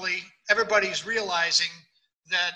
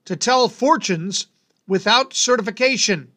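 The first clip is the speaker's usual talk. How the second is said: announcer read; 7 dB louder than usual. Acted. Reads bizarre-law segment over music.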